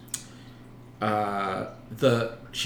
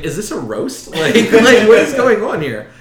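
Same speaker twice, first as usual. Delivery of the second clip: high, loud, laughing through the words; baffled laugh at an absurd question; laughing disbelief; 13 semitones higher, 14 dB louder, slower than usual. Retold or imitated. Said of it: "And I was just like".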